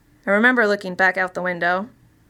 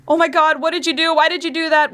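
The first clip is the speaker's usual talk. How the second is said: high, loud panicked cries; horrified screaming; 9 semitones higher, 4 dB louder than usual. Acted. Friends screaming at the driver in horror. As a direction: shriek it in shock and horror.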